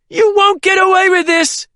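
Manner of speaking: high, loud desperate scream. A narrator's reading. Wounded woman screams at her captor. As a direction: scream defiance through the pain. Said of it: "she screamed".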